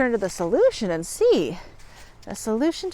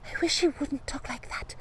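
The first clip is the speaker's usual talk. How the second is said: put-on very soft, higher girlish whisper; 4 semitones higher, 10 dB quieter than usual. A narrator's reading. Fanchon flinches at Bugs's talk, whispering. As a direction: whisper it timidly to your friend. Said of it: "Fanchon whispered".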